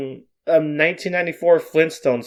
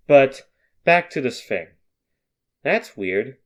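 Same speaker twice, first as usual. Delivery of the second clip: ordinary delivery, nothing marked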